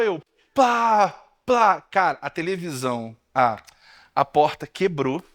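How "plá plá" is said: door bang sound. Retold or imitated. Banging cabinet door.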